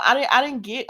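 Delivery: ordinary delivery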